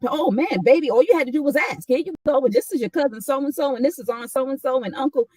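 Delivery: high, quick, doting voice as she hands over the photos; Aunt Deborah's delighted voice. Retold or imitated. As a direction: play the proud aunt who has a picture for everyone. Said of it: "So she'll pull out the".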